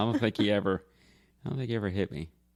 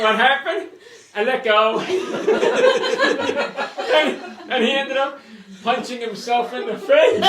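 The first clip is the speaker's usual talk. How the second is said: loud, high, laughing through the punchline; helpless laughter; 6 dB louder than usual. Real, not acted. Brother reveals the prank's ending, cracking up. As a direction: barely get it out for laughing.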